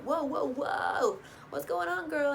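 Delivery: lightly voiced nervous guy; alarmed Blake. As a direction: imitate him bracing in the jeep. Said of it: "and Blake's like".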